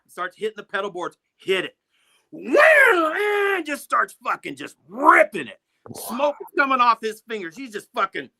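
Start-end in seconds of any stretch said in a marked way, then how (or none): 2.3-3.1 high, loud shouted wham; imitated guitar blast. Guitarist hits the pedals and rips, loud imitated blast. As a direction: burst out like a screaming guitar.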